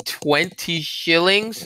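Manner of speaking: high, slow, stunned disbelief; stretched-out shocked cry; cried out in shock. A narrator's reading. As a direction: gasp out the sum like it can't be true. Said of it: "I cried".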